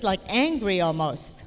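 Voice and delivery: ordinary talk, nothing marked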